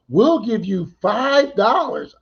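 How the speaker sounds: slow, high, drawn-out mockery; mocking offer. Mocking the ladies' five-dollar offer, high and slow. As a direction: stretch it out like a cheap joke.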